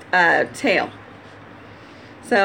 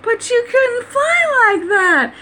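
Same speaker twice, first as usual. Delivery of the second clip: mock disbelief; 12 semitones higher, slower than usual. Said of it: "you'll be like".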